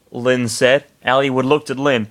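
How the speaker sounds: plain reading voice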